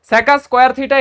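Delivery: সেকা square theta with an extra a after sec, said "seka"; seka instead of sec. Shown as a demonstration. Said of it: "উচ্চারণগত ত্রুটি যাতে না হয়".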